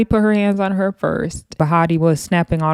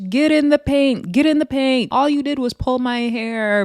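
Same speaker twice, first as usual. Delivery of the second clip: high-pitched mock taunt; acted challenge; 7 semitones higher than usual. Host voices Bahati wanting to fight again, mock challenge. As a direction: imitate a fighter calling someone out.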